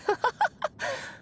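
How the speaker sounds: a bright, high burst of laughter; delighted high laugh. Acted. Friend laughing at Anya's love of happy endings, high and delighted.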